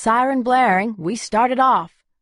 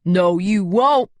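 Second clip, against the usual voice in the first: slow and louder, defiant; belligerent; 4 dB louder, slower than usual. A narrator's reading. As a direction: plant your feet and refuse. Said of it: "my wonderful belligerent male".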